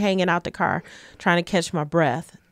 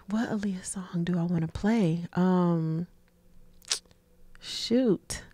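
whispered to herself; whispering; 9 dB quieter, slower than usual. Real, not acted. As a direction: whisper it while thinking. Thinking aloud to herself.

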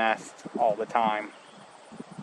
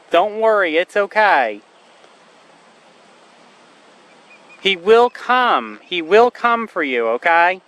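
reassuring the bird; 5 semitones higher, 6 dB louder than usual. Real, not acted. Soothing the osprey again.

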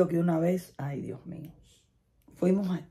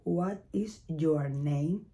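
mock overly careful learner; very slow stiff English; much slower than usual. Shown as a demonstration. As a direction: spell out "What is your name?" word by word. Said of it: "nadie dice".

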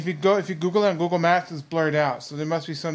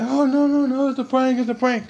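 mock Michael; 8 semitones higher, 4 dB louder than usual. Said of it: "he's like".

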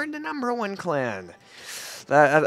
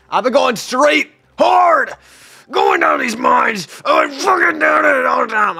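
frustrated shouting; 12 semitones higher, 8 dB louder than usual. Real, not acted. Worn-out rant about grinding mines.

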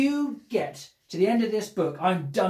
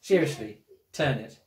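usual speaking voice

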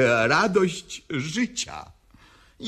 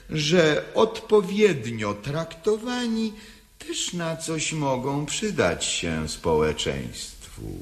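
ordinary delivery

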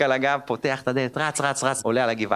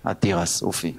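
tired mom; 9 semitones lower than usual. Retold or imitated. Mom answering, voiced low.

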